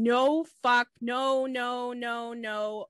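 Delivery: loud first cry, easing off; half-voiced imitation of panicked screaming; mock screaming. Podcaster retelling her cries on the balcony, mock screaming. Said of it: "she's screaming".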